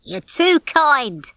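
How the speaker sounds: mock modesty. A narrator's reading. Nick thanks Rocky for insulting praise, modest. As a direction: draw it out as if flattered. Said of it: "said Nick modestly".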